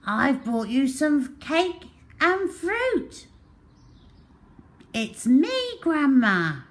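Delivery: high sweet put-on girl voice; disguised wolf at the door; wolf faking girl. A narrator's reading. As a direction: coax through the door in a girlish voice.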